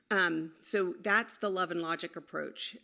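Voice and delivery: ordinary talk, nothing marked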